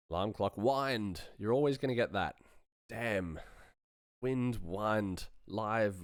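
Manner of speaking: normal talking voice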